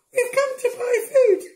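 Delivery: very high, squeaky brothers voice; brothers' voice. A narrator's reading. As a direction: pitch way up for the brothers.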